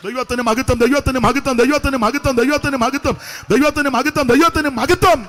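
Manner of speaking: full-force preaching. Real, not acted. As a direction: proclaim it at full voice, building to a shout. (ഉച്ചത്തിലുള്ള ആവേശ പ്രസംഗം)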